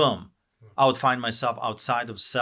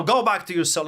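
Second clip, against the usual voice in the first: retold guard's command, pitched up; guard's order; 7 semitones higher, 4 dB louder than usual. Guard sending prisoner back to his cell, retold command. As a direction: raise the voice as an imitated guard.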